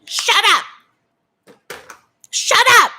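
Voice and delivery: high, loud hammed-up shut up; mock shout. Asking Nikki if HRH sounds like this, mock shout. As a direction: bark shut up again as her. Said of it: "Doesn't she sound like that".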